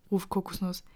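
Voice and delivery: ordinary delivery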